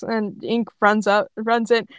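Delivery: usual speaking voice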